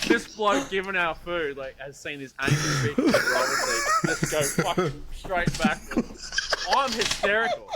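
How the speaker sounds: can barely get it out for laughing; laughing while telling. Real, not acted. Telling his mates about the reversing car, laughing hard.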